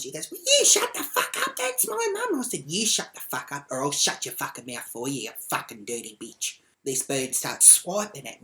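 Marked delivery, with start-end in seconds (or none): 0.3-2.4 high, screechy mock outburst; loud mimicked screech; losing it. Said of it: "then she fucking lost". 2.7-6.6 threat at normal pitch, no screech; steady, menacing comeback; retold threat.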